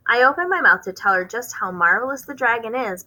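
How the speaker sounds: ordinary delivery, nothing marked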